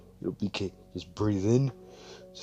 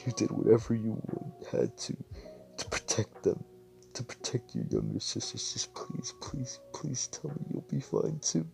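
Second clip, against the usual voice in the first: weep and plead at once; a broken, whispery crying voice; sobbing plea; 4 semitones higher than usual. Acted. Crying over dead parents.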